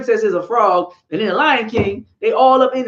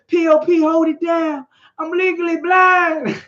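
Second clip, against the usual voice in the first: put-on quoting the girl; 6 semitones higher than usual. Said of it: "What the girl say?".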